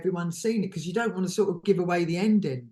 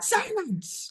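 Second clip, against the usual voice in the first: high-pitched theatrical order; big commanding character; 13 semitones higher than usual. Retold or imitated. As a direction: silence the room with mock authority. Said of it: "do this big sort of".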